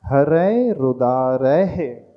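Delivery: slow, with the ऐ in हरै held long; long vowel pronunciation. Shown as a demonstration. Teacher showing students the long ऐ in हरै. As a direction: stretch the ऐ of हरै. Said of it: "ऐ अपने आप में दीर्घ मात्रा है".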